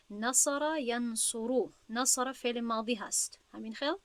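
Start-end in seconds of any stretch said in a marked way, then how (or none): 0.1-1.7 نصر ینصرو said very slowly, syllable by syllable; slow model word. Shown as a demonstration. Teacher gives the example verb, very slow. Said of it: "مثلاً ما داریم کلمه".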